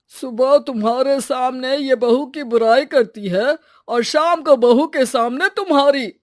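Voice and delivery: loud, emphatic telling-off; indignant exposé. Acted. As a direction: drive the accusation home loudly. Father-in-law exposes the neighbour.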